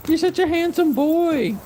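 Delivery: very high, slow cooing. Real, not acted. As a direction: stretch it out, cooing high to the dog. Baby talk to dog.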